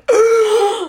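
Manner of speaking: loud high theatrical gasp; mock crowd gasp.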